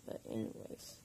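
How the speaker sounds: drowsy, low-energy voice; sleepy after anesthesia; tired. Real, not acted. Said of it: "I'm still hella tired".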